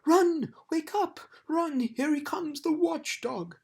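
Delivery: high, loud frantic yells; panicked shouting. Acted. Lethargarians fleeing the watchdog, high loud panic. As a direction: scream warnings and run. Panicked warning as dog arrives.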